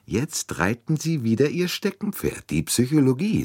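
smiling. Acted. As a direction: tease him, smiling. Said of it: "sagte Monsieur Bouc lächelnd".

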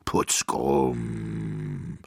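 deep giant muttering to himself; low, self-absorbed giant mumble; murmuring giant. A narrator's reading. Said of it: "murmelte er vor sich hin".

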